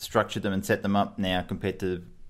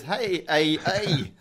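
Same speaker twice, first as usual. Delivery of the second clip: higher mock Santa calling "hey, hey, hey", breaking into a laugh; hammed-up Canadian Santa chuckle; 8 semitones higher, much slower than usual. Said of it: "He says".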